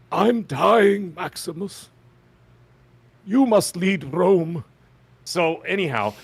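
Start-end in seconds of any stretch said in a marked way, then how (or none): 0.1-4.6 high, slow, strained dying words; dying movie hero. Pretend. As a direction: play the fallen Roman to the hilt, slowly. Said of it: "pretty good impression of".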